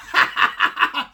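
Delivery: short breathy derisive laugh; scornful laugh. Real, not acted. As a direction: laugh off the dumb advice. Mocking rival's dating advice.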